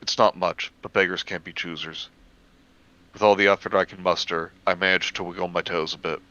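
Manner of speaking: ordinary delivery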